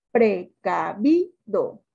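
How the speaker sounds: "Precavido" in slow separate syllables, VI punched; stress on VI. Shown as a demonstration. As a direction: sound out "Precavido" slowly, hitting "vi". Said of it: "¿Sílaba tónica?". ("Precavido" lento y separado, con fuerza en VI)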